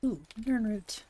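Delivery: normal talking voice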